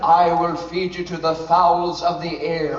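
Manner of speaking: slow, low giant threat; menacing giant, slowly. Shown as a demonstration. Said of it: "I think he said something like".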